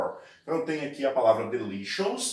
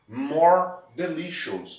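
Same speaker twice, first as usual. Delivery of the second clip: "More delicious" said slowly and clearly; slow model phrase; 4 semitones higher, 3 dB louder, slower than usual. Shown as a demonstration. Teacher modelling the English phrase, slow and clear. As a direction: lay out each word slowly for the class. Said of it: "delicious quer dizer delicioso".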